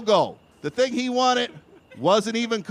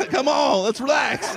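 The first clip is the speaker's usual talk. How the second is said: mock plea to relax; 6 semitones higher than usual. Pleading mock.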